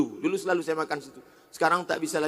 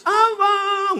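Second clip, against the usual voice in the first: high cry, imitating a wife; mock wail; 14 semitones higher than usual. Preacher imitates a crying wife at the funeral, high wail. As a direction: call the husband's name like wailing.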